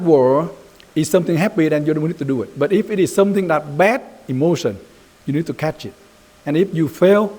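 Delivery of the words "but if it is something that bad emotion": normal talking voice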